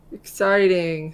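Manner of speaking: flat, slow "exciting" without any joy; unenthusiastic. Real, not acted. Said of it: "that exciting didn't sound very enthusiastic".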